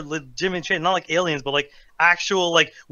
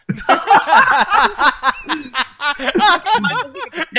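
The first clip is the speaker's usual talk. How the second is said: high, loud wheezing laugh; high burst of laughter; 11 semitones higher, 6 dB louder than usual. Real, not acted. Laughing at the potato joke.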